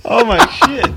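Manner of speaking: laughing out, pitched high. Real, not acted. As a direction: burst out at the punchline. Reacting to punchline.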